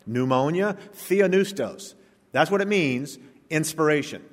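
normal talking voice

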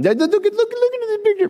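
mock gushing excitement; excited gushing. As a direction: gush over the photo, stumbling. Excited photo-showing quote.

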